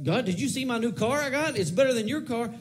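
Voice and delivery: high-pitched mock bragging to God; boastful. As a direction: show off like a kid with a new toy. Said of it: "not boastfully".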